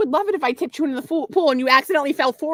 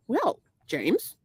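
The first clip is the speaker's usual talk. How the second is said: composed, faintly Irish formal opening; put-on Irish accent; 4 dB quieter than usual. Restarting her speech to bank caller James, put-on Irish accent. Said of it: "tell me what kind of accent".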